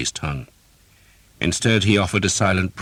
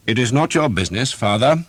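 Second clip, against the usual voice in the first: cold dismissal; 8 semitones higher, 5 dB louder than usual. A narrator's reading. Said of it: "Sir Simon said".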